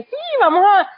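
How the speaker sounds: high-pitched mock enthusiasm; imitated eagerness. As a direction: act out a keen volunteer jumping in. Reenacts their eagerness to guide.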